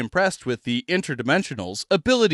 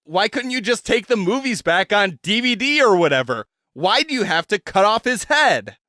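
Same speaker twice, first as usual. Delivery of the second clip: accusing and authoritative; 6 semitones higher than usual. Acted. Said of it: "her tone accusing and authoritative".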